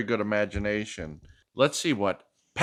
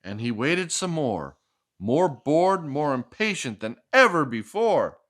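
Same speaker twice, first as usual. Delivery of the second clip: lively picture-book read-aloud; reading to a child; 4 semitones higher than usual. Acted. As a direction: read it like a bedtime story for a little one.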